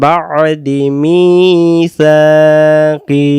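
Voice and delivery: slow, drawn-out chanted recitation; Quran recitation. Shown as a demonstration. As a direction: stretch every vowel like tilawat. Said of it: "teacher chants the verse". (ধীর সুরেলা তিলাওয়াত)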